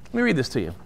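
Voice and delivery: ordinary delivery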